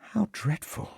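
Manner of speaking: hushed, high shocked butler; soft and stunned, as if winded; shaken. A narrator's reading. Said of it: "visibly shaken".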